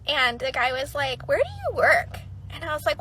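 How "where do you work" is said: much higher, light voice for the guy; curious sushi guy. Retold or imitated. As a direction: pitch him way up and a bit brighter.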